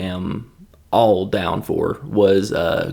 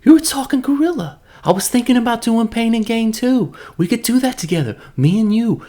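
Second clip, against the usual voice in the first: much higher, eager mock pitch to a chimp; Mark Wahlberg impression; 10 semitones higher than usual. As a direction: pitch the ape a movie deal in a goofy high voice. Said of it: "The original scene was".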